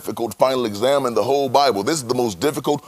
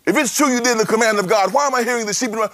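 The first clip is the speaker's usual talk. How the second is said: Samuel's accusing demand; 10 semitones higher, much faster than usual. Acted. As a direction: fire the question like an accusing prophet. Preacher voicing Samuel's demand.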